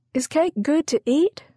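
put-on very high little-girl voice; small child's question. Five-year-old Daisy asks the cook about cake, tiny high voice. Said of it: "asked Daisy".

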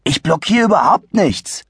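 put-on mildly cross kid; gruff boy. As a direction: brush him off grumpily. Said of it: "erklärte Adi unwirsch".

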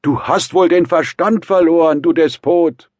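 high, loud outraged bellow; full-force indignant yell; roaring. A narrator's reading. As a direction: roar at your friend in outrage. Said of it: "brüllte Rasumichin".

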